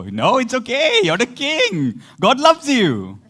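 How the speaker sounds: high, gushing mock comfort; mock reassurance. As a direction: coo it high, drawing out the last words. Mock reassurance of confessing David.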